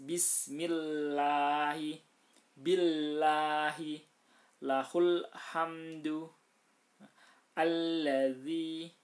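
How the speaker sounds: slow, loud recitation with the lam kept light and thin. Shown as a demonstration. Teacher reciting examples of the thin lam, slow and loud. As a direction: recite slowly, keeping each lam thin.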